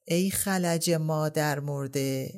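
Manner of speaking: drawn-out, grieving cry; slow lament. Acted. Coachman lamenting the injured carter. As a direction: mourn him slowly, word by word.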